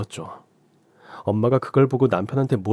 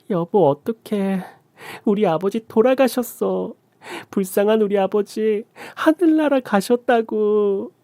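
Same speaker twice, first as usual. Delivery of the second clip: much higher, slow mock wailing; fake crying; 10 semitones higher, slower than usual. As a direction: fake grief to fool the husband. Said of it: "전 연기를 이어갔습니다".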